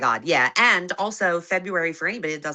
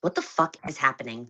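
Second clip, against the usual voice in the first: normal talking voice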